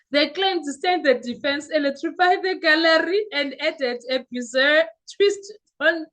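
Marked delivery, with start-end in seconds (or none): none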